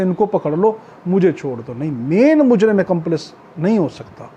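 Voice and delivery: ordinary talk, nothing marked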